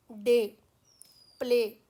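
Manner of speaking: डे and प्ले with a flat pure "e", no glide; flat Indian डे, प्ले; Indianised vowel. Shown as a demonstration. Teacher showing how Indians flatten डे and प्ले. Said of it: "हमने इसको बना दिया है".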